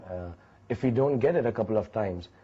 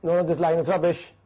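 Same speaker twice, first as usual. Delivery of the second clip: loud, higher mock scream of protest; retold scream; 5 semitones higher, 4 dB louder than usual. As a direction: yell it like a diva refusing the line. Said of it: "Kajol on the sets will only scream out".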